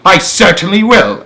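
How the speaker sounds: snapping. Acted. Spiker snapping her answer to Sponge, loud. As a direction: snap it back at once. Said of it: "Aunt Spiker snapped".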